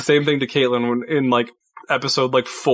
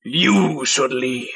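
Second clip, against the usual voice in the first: slow menacing threat; 2 semitones lower, much slower than usual. Acted.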